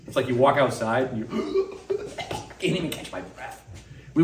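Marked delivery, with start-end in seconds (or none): 1.3-2.6 high unvoiced gasp as if suffocating in heat; mock gasp for air. Tourist stepping into tropical heat, gasping. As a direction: act out losing your breath.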